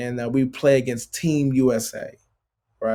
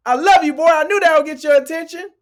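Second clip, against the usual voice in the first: high, loud and teasing; playful loud reply; 7 dB louder than usual. Retold or imitated. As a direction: laugh off the joke loudly. Cousins explaining the joke.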